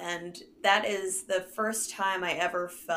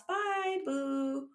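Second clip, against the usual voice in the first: mock farewell; 6 semitones higher, 4 dB quieter than usual. Real, not acted. Mocking farewell to DeVos.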